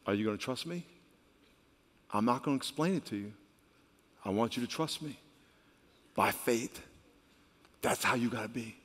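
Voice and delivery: very soft, low, measured voice; God's quiet answer. Acted. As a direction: speak low and hushed, as God insisting on trust. God answers the grieving father softly.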